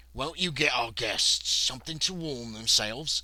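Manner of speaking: lightly voiced slow butler with a New Zealand hint; a touch of the formal butler, slowly; butler O'Brien. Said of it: "says O'Brien".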